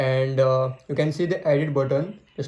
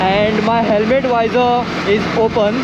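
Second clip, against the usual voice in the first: loud voice over wind noise; speaking loudly; 6 semitones higher, 5 dB louder than usual. Real, not acted. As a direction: raise your voice over the wind. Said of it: "I have to speak very loudly".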